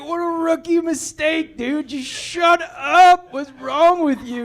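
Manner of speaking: self-scolding. Retold or imitated. Husband berating himself for complaining, high and loud. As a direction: chew yourself out, slowing on shut up.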